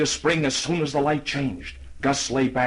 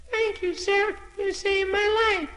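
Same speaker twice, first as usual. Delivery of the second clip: very high little voice; a tiny grateful snowflake. Acted. Tiny snowflake thanks horse.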